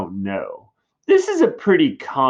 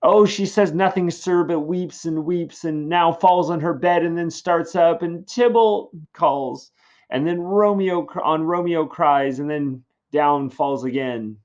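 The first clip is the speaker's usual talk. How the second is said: lamenting nurse; 7 semitones higher, 3 dB louder than usual. Acted. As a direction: wail the news out gently. Nurse describing weeping Juliet.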